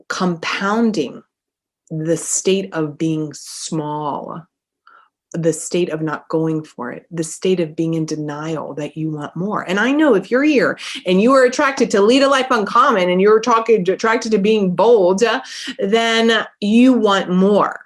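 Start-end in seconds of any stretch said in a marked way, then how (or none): 0.1-4.4 slow emphasis. Real, not acted. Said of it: "slow emphasis for coaching". 9.7-17.8 rousing push. Real, not acted. Building motivational push.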